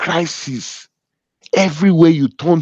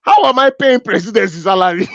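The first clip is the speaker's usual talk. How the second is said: incredulous question breaking into laughter; laughing disbelief; 4 semitones higher than usual. Acted. Boss wondering at the employee's presidency title, laughing disbelief. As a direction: ask in mock dismay, higher, tipping into a laugh.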